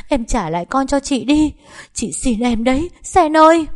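high, loud, desperate wail; anguished pleading. Acted. Grieving mother begging Sen for her baby, anguished. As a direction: beg desperately, rising to a cry.

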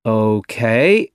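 OKAY lifting sharply at the end; rising okay. A narrator's reading. Doctor to his team, OKAY rising. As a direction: say OKAY with a clear upward lift.

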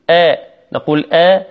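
آ as a thin front "ae"; light hamza. Shown as a demonstration. Teacher showing the correct light hamza آ. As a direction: keep آ light, never dark. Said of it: "اللام والهمزة مرققتين".

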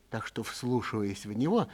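ordinary delivery, nothing marked

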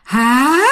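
long stretched "Hả?"; drawn-out Hả. Retold or imitated. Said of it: "nó kéo dài lắm". (kéo dài chữ "Hả?" thật lâu)

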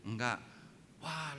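ordinary talk, nothing marked

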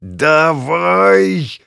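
high, loud, drawn-out yell; will it on with a long cry; straining shout. A narrator's reading. Pilot pulling out of the dive, straining shout.

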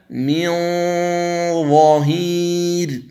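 ينظرون drawn out with the nasal hum held; slow tajweed recitation. Shown as a demonstration. Teacher demonstrating ikhfa before ظ, slow recitation. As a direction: chant ينظرون slowly through the nose. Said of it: "قوله تعالى".

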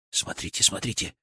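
soft, whispered urging; breath-held, near-whispered alert; hushed excitement. Acted. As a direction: draw their eyes without making noise. Hushed call to watch a catch.

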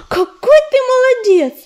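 high, loud joyful exclamation; delighted gasp. Acted. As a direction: gasp it out with joy. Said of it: "ахнула Листвичка".

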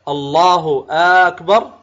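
"أكبر" opened on a long aa, rising like a question; lengthened hamza. Shown as a demonstration. Teacher imitating a faulty takbir that sounds like asking, lengthened hamza. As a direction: draw out the first a of "أكبر" as if asking. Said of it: "كأنه يقول".

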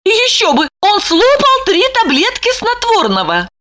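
high, frantic inner worry; anxious wife. A narrator's reading. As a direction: spiral into panic.

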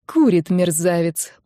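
ordinary delivery, nothing marked

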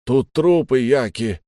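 high, projected reply; calling from above. A narrator's reading. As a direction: report loudly across a distance. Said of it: "ответили сверху".

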